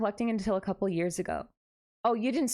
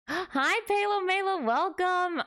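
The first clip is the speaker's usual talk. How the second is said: excited greeting; 9 semitones higher, slower than usual. Real, not acted. Greeting a new follower.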